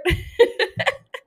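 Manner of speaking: high, loud laugh; amused laugh. Real, not acted. Laughing at their own spoiler slip, high and loud.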